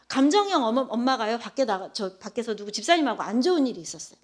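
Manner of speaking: ordinary delivery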